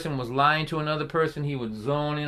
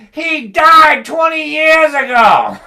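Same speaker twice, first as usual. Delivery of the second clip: slow and loud, much higher, each word landed; deadpan punchline; 13 semitones higher, 15 dB louder, much slower than usual. Retold or imitated. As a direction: drop the bombshell slowly and loudly.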